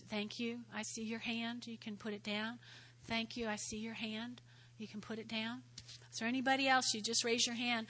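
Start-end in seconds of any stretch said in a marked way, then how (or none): none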